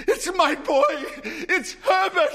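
very high, loud, desperate cry; frantic cry. Acted. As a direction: cry out for her son. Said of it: "she cried".

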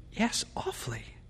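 whispered, uneasy reply in the dark; soft, voiceless answer; hushed dread. A narrator's reading. Frightened couple whisper at night.

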